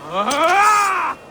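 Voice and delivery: loud high strained yell as a blow lands; battle yell. Acted. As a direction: shout out with effort. Fighting cry.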